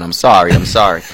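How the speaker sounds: ordinary talk, nothing marked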